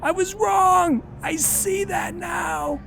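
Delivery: high, slow, strained; pleading wail; desperate admission. Acted. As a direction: repeat it pleading. Repeated desperate confession.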